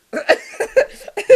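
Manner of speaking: high, loud burst of laughter; helpless giggling fit. Retold or imitated. High kid answering mom on the phone, helpless giggles. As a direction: crack up instead of answering.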